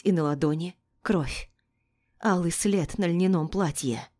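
usual speaking voice

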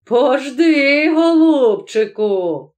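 mild, drawn-out shout; blacksmith's cry. Acted. Blacksmith threatening the devil he has caught, mildly shouted. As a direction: call out slowly with a mock-endearing threat. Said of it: "закричав коваль".

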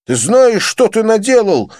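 high, loud furious bark; barked accusation. A narrator's reading. Johnny barks at drunk Joseph, furious. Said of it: "рявкнул Джонни".

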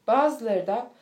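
ordinary delivery, nothing marked

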